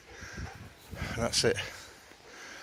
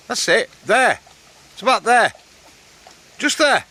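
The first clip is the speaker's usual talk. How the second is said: high, loud calls across the field; calling out to dog; 14 semitones higher, 8 dB louder than usual. Real, not acted. Man calling to his dog hunting a rock in the water, high loud calls. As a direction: call out to the dog, pitched way up.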